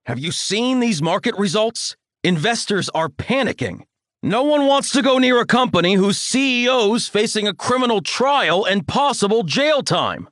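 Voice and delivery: high, blustering tirade; ranting father. A narrator's reading. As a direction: rant at your son like an outraged father. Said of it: "bombastic tirade".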